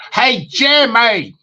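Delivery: slow, high Southern drawl on Jamie; Southern twang impression. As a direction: stretch it out like a country caller. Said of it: "I like to do impersonations".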